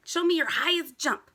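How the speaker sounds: plain reading voice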